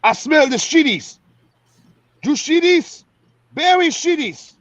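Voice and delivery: high mock Ugandan accent, imitating a drill sergeant; imitating a Ugandan drill sergeant, pitched high. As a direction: play the Ugandan drill sergeant sniffing out the culprit. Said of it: "'cause he had a Ugandan accent".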